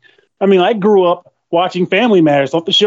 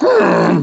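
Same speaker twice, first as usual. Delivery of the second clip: high, raspy character groan; grinding throaty grumble, pitched way up; Marge Simpson groan. Acted. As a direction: imitate a worn-out cartoon mother's rumbling groan. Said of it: "They should just have you play Marge".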